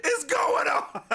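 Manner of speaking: very high, slow comic wail; high-pitched hammed-up cry of confusion; mock bewildered outburst. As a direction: imitate a baffled Shakespeare, squealing slowly. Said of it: "Shakespeare's like".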